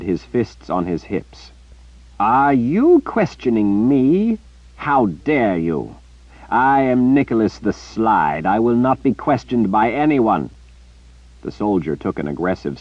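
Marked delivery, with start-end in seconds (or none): 2.2-10.5 louder, haughty fury; how dare you, full pride; indignant outrage. Acted. Nicholas outraged at being questioned.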